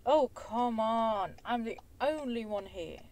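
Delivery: ordinary talk, nothing marked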